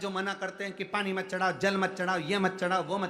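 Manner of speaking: preaching at full force. Acted. Preacher rallying devotees barred from the temple, fervent and loud. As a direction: rouse the whole crowd behind Mahadev.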